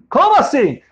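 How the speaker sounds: high, loud disbelief; shocked question. Acted. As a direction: blurt it out in shock. Boris shocked at being expelled. (pergunta chocada, alta e incrédula)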